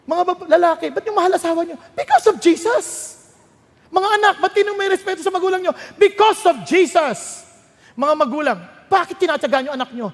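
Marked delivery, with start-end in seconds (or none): 1.9-2.9 loud, high-pitched cry of conviction; ringing preacher's refrain; emphatic rallying answer. Acted. Same refrain answering the question. 5.9-7.4 loud, high, drawn-out refrain; preacher hammering the answer home; emphatic rallying answer. Acted.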